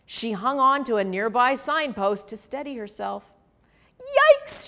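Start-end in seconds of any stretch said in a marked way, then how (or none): 4.0-4.7 high, loud yelp; startled yelp. A narrator's reading. Polly blown off her feet, high loud yelp. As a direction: yelp as the wind hits. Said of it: "she yelled".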